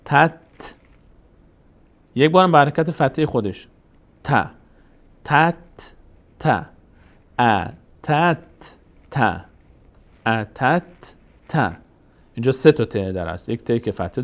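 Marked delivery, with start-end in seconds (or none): 0.0-0.7 "تت" closed sharply on the final t, no vowel after; teacher demonstrating a vowelless ت in "تت"; vowelless consonant. Shown as a demonstration. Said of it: "یک بار به صورت ساکن". 5.2-11.8 "ا، تت، ت" syllable by syllable, pausing between the two t sounds; teacher's split demo of doubled ت; doubled consonant. Shown as a demonstration. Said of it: "این ت دو بار باید خوانده بشه".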